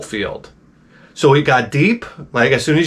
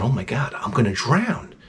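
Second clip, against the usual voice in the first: scared; 3 semitones lower, 7 dB quieter than usual. Retold or imitated. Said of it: "now I start to get scared".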